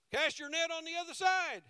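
Jesus calling to the boat; called command. Retold or imitated. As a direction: shout it across the water.

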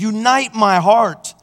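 slow, high pleading prayer; David's prayer, earnest and slow; fervent prayer. Pretend. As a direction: plead with God to unite the heart. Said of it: "David said".

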